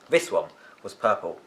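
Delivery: plain reading voice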